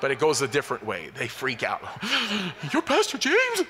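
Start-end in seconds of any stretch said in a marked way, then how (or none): 1.9-2.7 gasp. Retold or imitated. Fan meeting pastor first time. 2.7-3.6 much higher and louder, disbelieving squeal; shocked fan. Retold or imitated. Fan meeting the radio pastor in person, mock shocked.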